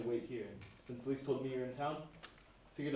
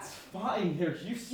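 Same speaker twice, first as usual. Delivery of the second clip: louder and higher, brushing her off; rising irritation; 7 semitones higher, 6 dB louder, much slower than usual. Acted. Jack waving off Caroline's worry, irritated. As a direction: snap back defensively. Argument escalating, interrupted.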